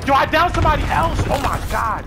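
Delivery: high, loud hype yell; excited shout. Real, not acted. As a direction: shout it out, thrilled. Excited kill call.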